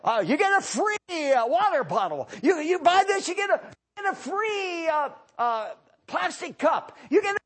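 lightly voiced radio huckster, high and loud; a hint of a pushy radio pitchman; mock radio ad. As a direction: hawk freebies like a radio ad. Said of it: "every advertisement on any radio program".

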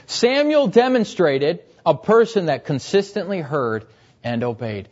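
ordinary talk, nothing marked

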